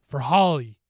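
sudden loud swell of feeling; impassioned dedication. A narrator's reading. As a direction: push the name out hard. Hero avenges a lost friend.

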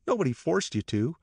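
high, pressing question; demanding. A narrator's reading. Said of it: "demanded Catherine".

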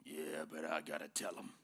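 low, grudging protest in a put-on voice; voice of the flesh. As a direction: play the stubborn inner urge talking back. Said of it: "your flesh is like".

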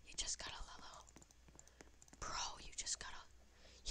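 "lello" in a soft unvoiced whisper; whispered teasing. Teasing Thunder Dragon over the yellow, whispered. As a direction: breathe it like a secret tease.

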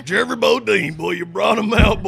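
loud, high hillbilly character voice; Jeffrey Bodine voice. Acted. Guest greeting the host in his character voice, loud and high. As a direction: belt it out as a rowdy country boy.

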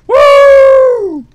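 loud, high celebration cry; cheering a win; victory whoop. Real, not acted. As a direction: let it rip in triumph. Celebrating in game.

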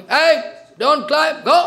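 loud, slow, high angry shout; stepmother's shout. Retold or imitated. Stepmother chasing the boy off the king's lap, loud angry shout. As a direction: bark him off the king's lap.

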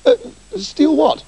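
the pickpocket doctor squeaking out a fake innocent question; feigned innocence. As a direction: play dumb, all wide-eyed.

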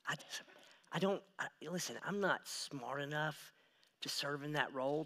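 insecure confession. Retold or imitated. Voicing an insecure believer.

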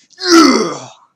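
high burst of laughter; loud laugh. Real, not acted. After a lucky round.

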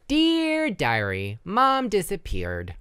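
put-on high slow child reading; childlike diary voice. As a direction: read it like a little girl. Reads diary.

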